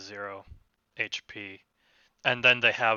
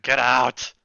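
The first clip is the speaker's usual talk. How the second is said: loud, grumbly order to leave; grumbling; 12 dB louder than usual. Acted. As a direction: grumble it out loudly, shooing them off. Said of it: "He kinda grumbles".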